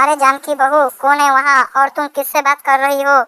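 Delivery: put-on very high-pitched old mother-in-law voice; inquiring mother-in-law. Mother-in-law asks daughter-in-law who has come, inquiring. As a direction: ask in a high put-on elder's voice.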